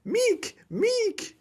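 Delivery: squeaky high alien saying its own name; tiny alien Meek voice. Retold or imitated. Joking about Meek in Fortnite, squeaky high alien voice. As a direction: pitch way up like a small creature. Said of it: "'cause that's his voice or whatever".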